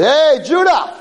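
high, loud call of the big angel. Acted. Angel greeting the dead Judah, a high loud call.

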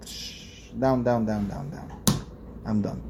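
fading into a breathy hush; whispered sinking. Shown as a demonstration. Preacher showing the wicked man's fall, fading whisper. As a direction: let the words sink away to nothing. Sinking gesture after quote.

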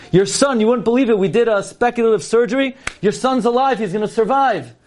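high, excited announcement; imitating a thrilled doctor, voice lifted high; beaming good news. Beaming doctor announces son survived.

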